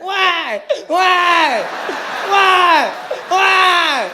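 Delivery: high, loud, wailing repeated whine; mock whining baby cry.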